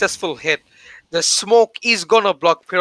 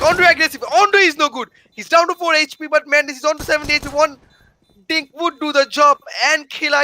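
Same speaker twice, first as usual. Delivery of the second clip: excited play-by-play; 7 semitones higher than usual. Real, not acted. Tense clutch round play-by-play.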